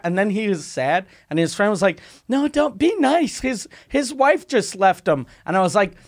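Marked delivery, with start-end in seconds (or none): 2.3-5.2 high, slow mock plea; imitated pleading. Drunk man's friend asking for mercy, imitated pleading. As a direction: plead on the friend's behalf, pitched well up.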